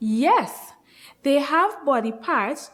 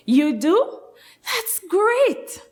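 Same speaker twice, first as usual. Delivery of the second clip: high, bright praise; excited praise; 8 semitones higher, slower than usual. Acted. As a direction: cheer the class on. Praising children.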